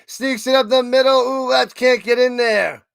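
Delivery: fast, punchy game call over the action; live play-by-play. Real, not acted. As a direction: call the run as it happens.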